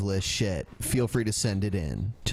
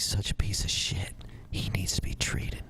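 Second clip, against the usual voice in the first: whisper; 4 semitones lower, 5 dB quieter than usual. Acted. Mock sinister aside.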